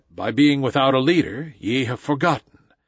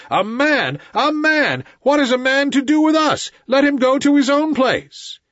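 high, loud shouting wolves; pack yelling; 12 semitones higher, 5 dB louder than usual. A narrator's reading. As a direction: yell it out as an angry crowd. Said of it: "more than half of the pack yelled".

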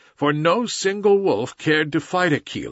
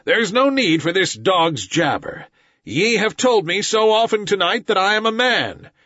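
raised, loud furious cry; furious boy; 8 semitones higher, 3 dB louder than usual. A narrator's reading. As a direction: shout it with rage and sorrow. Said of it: "he cried".

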